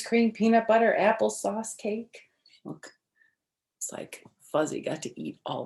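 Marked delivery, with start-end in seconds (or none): none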